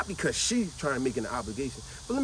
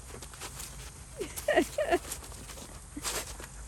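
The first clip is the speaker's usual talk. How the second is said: airy, very high delighted laugh; high giddy laugh; 7 dB quieter than usual. Retold or imitated. Boy laughs giddily when his uncle hands him a hundred-dollar bill. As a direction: laugh like a thrilled kid handed cash.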